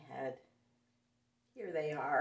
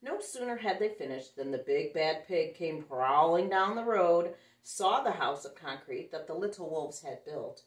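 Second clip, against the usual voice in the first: story narration; 10 dB louder than usual. A narrator's reading. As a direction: read the pig's approach big and ominous. Reads picture book aloud.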